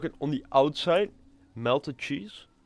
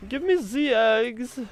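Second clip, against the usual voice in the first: high, slow mock German accent; exaggerated German accent, high and drawn out; German guy voice; 12 semitones higher, slower than usual. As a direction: ham up a squeaky German character thanking you. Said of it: "I'm suddenly a German guy".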